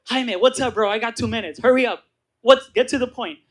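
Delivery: acted phone impatience; impatient. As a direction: push him to get to the point. Hurrying cousin on short phone minutes.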